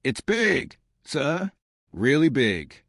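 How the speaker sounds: a bright, eager report of something huge; thrilled officer reporting a big contact; excited. A narrator's reading. Officer to captain, reporting signals, excited. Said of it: "Her voice was excited".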